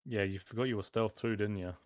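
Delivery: ordinary delivery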